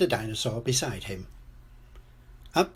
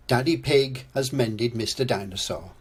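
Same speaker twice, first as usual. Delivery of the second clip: ordinary delivery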